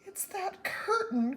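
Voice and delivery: high, slow cry of worry. A narrator's reading. Mother cries out to her daughter about the curtain, high and slow. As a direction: wail it out in alarm. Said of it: "cried Homily".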